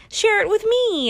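high, wheedling invitation; playful plea. Pretend. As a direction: coax it out sweetly high. High pleading tease.

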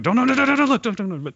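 high, frantic string of no's; panicked no no no. Retold or imitated. Telling his wife not to look at the lookalike, frantic and high. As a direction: wave her off in a rush of panic.

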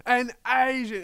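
high mock yell, loud on the first word; retold disgusted yell. Yelling in a poor guy's face, mock disgusted yell. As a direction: imitate yelling "yuck" in disgust. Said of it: "yelling in a poor guy's face".

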